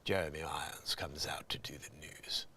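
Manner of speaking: very low, hushed news voice; near-whispered impression of a grave newsreader; Jeremy Irons. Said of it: "you gotta love it when Jer".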